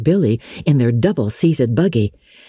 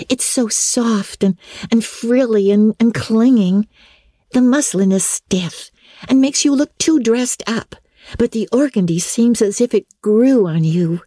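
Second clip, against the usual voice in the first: put-on higher, admiring young friend, a touch dreamy; girl persuading her friend, lightly voiced higher; lightly voiced girl Diana; 9 semitones higher than usual. A narrator's reading. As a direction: coax her friend toward the organdy.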